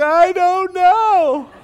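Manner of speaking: put-on very high, loud, drawn-out child's wail; mock crying wail. Daughter answering dad's question, exaggerated mock crying. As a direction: imitate a little daughter bawling. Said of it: "Why are you crying?".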